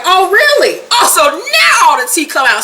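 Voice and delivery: high, mock-outraged comeback; indignant. Scorned woman confronting her cheating man, indignant. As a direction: act out a scandalized confrontation.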